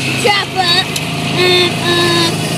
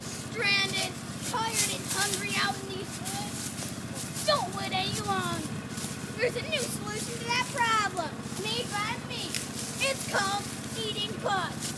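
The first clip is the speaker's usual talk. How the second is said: softer infomercial pitch; ad read; 16 dB quieter than usual. Pretend. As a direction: sell it like a TV ad. Mock commercial ad read.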